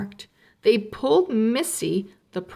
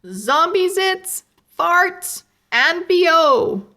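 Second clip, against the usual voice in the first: high, loud barker's yell; 9 semitones higher, 6 dB louder than usual. A narrator's reading. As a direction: shout it to the whole street. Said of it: "yelled Webster".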